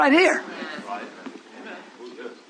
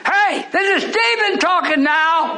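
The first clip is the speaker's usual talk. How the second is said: full-force preaching; 6 semitones higher, slower than usual. Real, not acted. Preacher echoing scripture back to the congregation, fervent shout. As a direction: echo the reader and hammer each phrase. Preaching Stephen's sermon with call and response.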